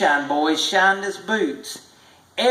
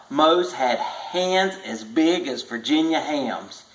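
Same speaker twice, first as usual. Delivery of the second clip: ordinary delivery, nothing marked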